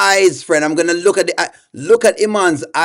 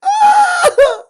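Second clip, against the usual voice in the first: mock wail. Mocking Iman crying on stream.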